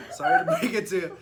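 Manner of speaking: loud, laughing through the words; teasing through big laughs; laughing mock apology. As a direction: break the bad news while cracking up. Cracking up delivering mock bad news.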